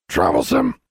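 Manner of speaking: loud, harsh bird squawk; squawking bird Charlie. A narrator's reading. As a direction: squawk the word like a talking bird. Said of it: "squawked Charlie".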